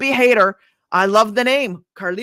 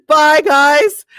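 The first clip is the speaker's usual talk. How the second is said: high, loud farewell shout; loud goodbye; 6 semitones higher, 12 dB louder than usual. Real, not acted. Host signing off to viewers, shouting goodbye. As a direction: call it out as you leave.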